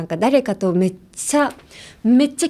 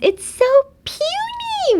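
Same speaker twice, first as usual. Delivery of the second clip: very high, squealing puny; cutesy gushing; much slower than usual. Shown as a demonstration. Showing learners how natives coo, high squeal. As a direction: squeal it with adoration. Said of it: "みんなこんな感じで言います".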